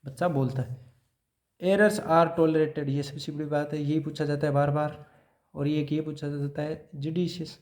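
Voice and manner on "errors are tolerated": very slow, each word weighed; slow dictation. Shown as a demonstration. Teacher stressing an exam point for notes, slowly. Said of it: "teacher dictating a principle".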